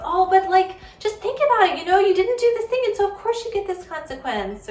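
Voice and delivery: high, loud, fast pleading lecture; over-explaining parent. Retold or imitated. Parent lecturing an upset kid.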